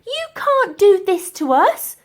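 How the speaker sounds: high, loud, slow shout; yell. Acted. Elsie defying the professor, loud high yell. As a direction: yell it at him in protest. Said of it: "yelled the girl".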